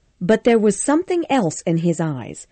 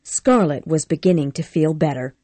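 usual speaking voice

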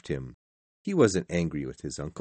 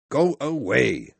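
loud, raised order to leave; angry dismissal; 5 semitones higher, 6 dB louder than usual. A narrator's reading. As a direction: send him off angrily. Scrooge dismisses his nephew.

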